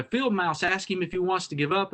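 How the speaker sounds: normal talking voice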